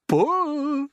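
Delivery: high, loud drawn-out command; old grasshopper's urgent bellow; shout. A narrator's reading. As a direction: shout it to a hauling team. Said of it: "shouted the old green grasshopper".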